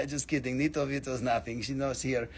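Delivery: ordinary talk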